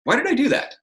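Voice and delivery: high, light, curious question; open questioning. Shown as a demonstration. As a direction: ask yourself, open to any answer. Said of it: "It says I'm open to an answer".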